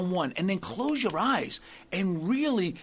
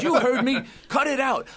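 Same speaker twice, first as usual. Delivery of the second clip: raised, higher voice of an insistent priest; mock priest's scolding; 8 semitones higher, 6 dB louder than usual. Priest to puzzled penitent, mock scolding. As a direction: play the priest insisting, loudly.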